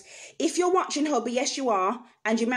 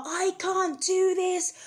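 high, slow, strained words of despair; desperate plea; 10 semitones higher, slower than usual. Retold or imitated. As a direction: plead as if at breaking point.

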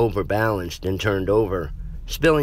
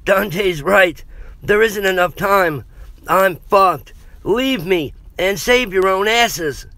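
raised, loud, strained voice; pained urging; 10 semitones higher, 4 dB louder than usual. A narrator's reading. Injured Jeff tells friends to flee, pained and urgent. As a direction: push through pain to make them leave. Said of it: "a grimace of pain fixed".

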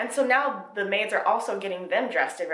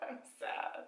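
tiny whispered admission; whispered sadness; 15 dB quieter than usual. Real, not acted. Reader admits to viewers she is sad, whispered. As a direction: breathe it out, defeated. Reader deflated after laughing.

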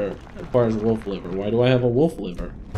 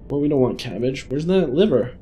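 usual speaking voice

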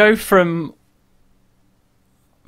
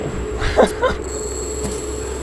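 breathy relieved laugh; 5 dB quieter than usual. Real, not acted. After winning.

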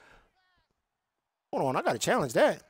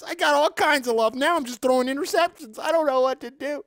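higher, put-on voice of a sulking player; mock whiny player; 7 semitones higher than usual. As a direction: whine about his lost touch. Acted man lamenting his game.

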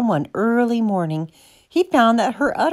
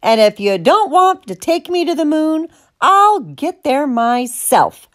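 high, indignant outburst; high cow defiance; defiant cry; 6 semitones higher than usual. A narrator's reading. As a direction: cry it out, slowing hard on the last words. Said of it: "she cried".

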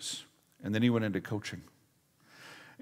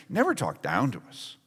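ordinary talk

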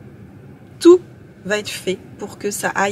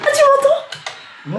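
appel fort; 3 dB louder, much slower than usual. Real, not acted. Interpelle Eric au casque.

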